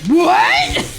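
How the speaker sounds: high startled what; shocked what. Retold or imitated. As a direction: blurt it out in shock. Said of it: "I was like".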